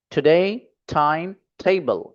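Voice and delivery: Time table said slowly, stress on table; slow and even, lean on table; slow model reading. Shown as a demonstration. Teacher drills class, stressing table. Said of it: "तीन बार पढ़ना है आपको इस तरीके से".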